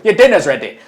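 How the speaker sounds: high, loud mum calling dinner; calling kids to dinner. Pretend. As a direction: call him in like a parent. Calling Gaz like a parent.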